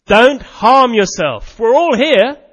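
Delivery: slow, loud, high urgent shout. Acted. Paul shouting to the jailer about to kill himself, loud and high. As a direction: call out to stop him, loud and slow. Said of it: "And he shouts".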